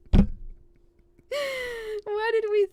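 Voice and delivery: high, long drawn-out wail; exasperated wail. Real, not acted. Reader regretting the German accent choice, exasperated wail. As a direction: lament it loudly, stretching the words.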